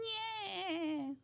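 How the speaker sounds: squeaky high cartoon laugh. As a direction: let out a goofy sponge laugh. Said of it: "Oh, I nailed that laugh".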